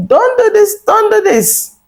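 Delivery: hammed-up high plea; mock warning. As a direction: squeak it out like a fretting parent. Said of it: "these people were telling you".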